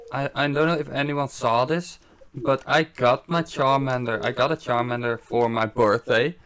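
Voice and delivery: ordinary delivery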